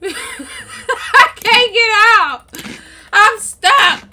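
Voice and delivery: drawn-out, high whine of despair; mock-crying wail. Gamer trapped in the game, mock-crying wail. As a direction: wail it slowly like a stuck kid.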